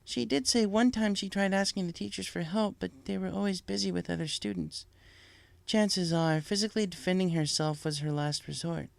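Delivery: lightly voiced princess; Celestia, gentle and regal.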